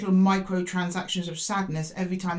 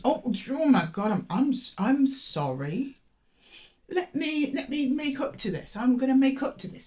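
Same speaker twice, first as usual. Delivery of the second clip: high-pitched, pleading mock apology; flustered apology; 6 semitones higher than usual. An ex apologising to make up for it, flustered mock plea. Voicing the other person's panicky apology.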